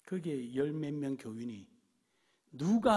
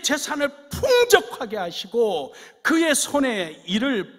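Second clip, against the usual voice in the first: fervent blessing; 9 semitones higher, 14 dB louder than usual. Acted. Pastor pronouncing God's blessing on the church, loud and fervent. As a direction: pour the blessing over them.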